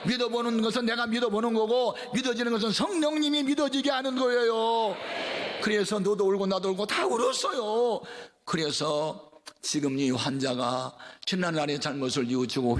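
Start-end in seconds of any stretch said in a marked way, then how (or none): 0.1-8.0 high, ringing sermon voice; fervent preaching. Acted. Pastor preaches to the congregation, raised and fervent. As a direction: preach it with rising fire.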